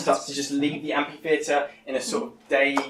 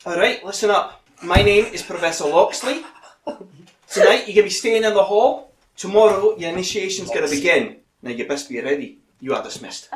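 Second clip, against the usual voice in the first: brisk Scottish professor calling the hall to order; Scottish accent; 7 semitones higher, 8 dB louder than usual. Acted. Professor to new students, loud Scottish accent.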